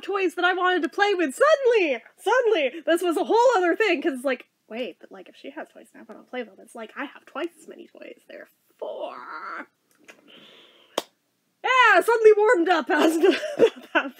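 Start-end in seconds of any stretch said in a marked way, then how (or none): 4.7-9.7 soft, rapid mock scheming, slowing on the last word; sly reasoning. Child working out the toy advantage, quiet mock scheming. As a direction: think it through quietly, as a calculating kid.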